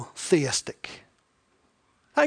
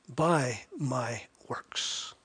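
whispered, trailing off very slowly; 5 semitones lower, 8 dB quieter, much slower than usual. Acted. Preacher reading James, dropping to a slow whisper. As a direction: breathe the last words out, slow.

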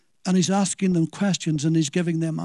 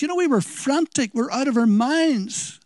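frantic mother; 6 semitones higher than usual. Retold or imitated. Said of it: "she probably says".

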